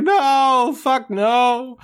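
high, loud comic wail of denial; mock horrified cry. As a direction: cry out in hammed-up dismay. Retold Scrooge seeing his grave.

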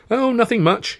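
high, sing-song lilt; trilled reply. A narrator's reading. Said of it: "he trilled".

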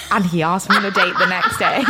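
words dissolving into real laughter; laughing punchline. Real, not acted. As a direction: deliver the punchline, then crack up. Cracks up at story's punchline.

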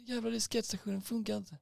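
high, sputtering angry customer; mock fury. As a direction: sputter curses in a hammed-up high voice. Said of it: "han är så jävla arg fortfarande".

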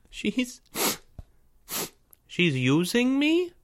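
high, halting, wounded question; tearful disbelief. A narrator's reading. Tearful boy learning Maya uses him, disbelieving. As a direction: stammer it out in a small high voice.

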